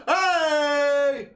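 loud, high excited Hey; excited shout. Real, not acted. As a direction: call out in sudden delight. Calling out excitedly at a gift.

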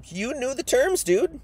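high-pitched, casual mock reproach; God, reproachful. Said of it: "God says".

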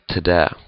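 träd said as "tuh-dä". Shown as a demonstration. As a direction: put a clear D where the R would be in träd. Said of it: "it will sound like".

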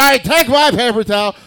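loud, high-pitched emergency command; urgent retold order. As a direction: act out shouting instructions in a crisis. Retelling urgent order during the fire.

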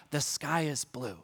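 put-on soft hushed "The sky is blue," as if testing a child's ear; a gentle mock whisper, lips near the ear; imitated teacher whisper. Teacher whispering to a child at the hearing test, softly hushed. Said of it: "whisper a sentence".

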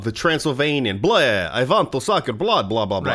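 mock Transylvanian accent with swooping pitch; Transylvanian vampire. Player mocking how everyone voices Ustalav, hammy Transylvanian vampire accent. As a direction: ham up a Transylvanian vampire's bleh and blah. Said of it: "accent, 'cause, you know, vampire country".

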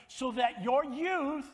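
slow rising shout on like the eagles; preaching shout. Real, not acted. Preacher shouting to the congregation about eagles, slow and loud. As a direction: build it up and belt it out slowly.

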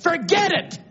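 shouted, high exultant preaching; preaching at full force. Real, not acted. Preacher rallying his congregation, shouted high exultation. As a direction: lift the congregation to shout praise.

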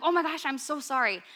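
imitating someone flustered after a slip; mock apology. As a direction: gush a mock-embarrassed sorry. Mimics people apologizing for cussing.

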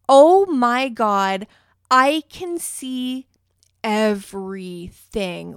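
shocked realization. Retold or imitated. To herself at the window, horrified at how visible everything is. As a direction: drag it out, horrified by what you can see. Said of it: "and I'm like".

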